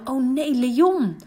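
mild alarm. Retold or imitated. Renske retelling her thought when they fell, mild alarm. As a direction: recall the fright. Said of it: "dacht ik alleen maar".

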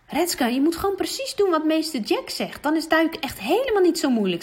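a brother trying to help, voice lifted; older brother voice. Acted. Brother Leon coaching his sister before diving, eager.

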